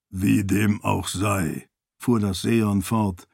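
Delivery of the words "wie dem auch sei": slow, lightly deepened voice; resume with measured, deep solemnity. A narrator's reading. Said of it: "Arshes tiefe Stimme". (langsame, leicht tiefe Stimme)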